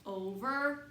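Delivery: ordinary delivery, nothing marked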